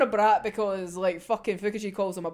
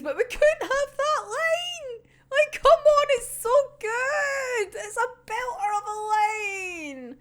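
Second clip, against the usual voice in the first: high, pained fan wail; anguished fan; 12 semitones higher than usual. Real, not acted. Vlogger wailing to viewers about a cut line, high and pained. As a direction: moan about the missing line, voice cracking high. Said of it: "that's just cruel".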